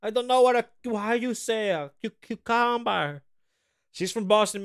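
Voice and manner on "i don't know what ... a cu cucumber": high-pitched, hammed-up Spanish accent; mock Spanish accent. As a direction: imitate a fake Spanish accent, groping for the English word. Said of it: "she speaks in like, she's speaking in Spanish like this".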